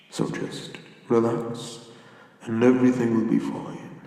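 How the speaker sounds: usual speaking voice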